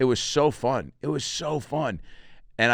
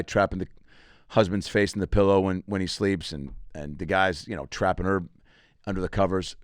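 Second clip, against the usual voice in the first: ordinary talk, nothing marked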